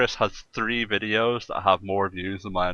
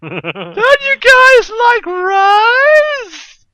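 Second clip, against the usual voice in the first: high, loud, slow drawn-out shout; shouted joke quote; 14 dB louder, much slower than usual. Retold or imitated. Podcaster quoting a Family Guy joke, loud slow shout. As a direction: bellow the line, stretching every word.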